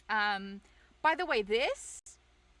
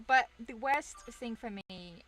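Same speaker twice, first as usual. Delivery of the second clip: usual speaking voice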